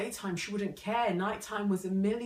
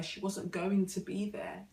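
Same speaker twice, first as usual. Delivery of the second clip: ordinary talk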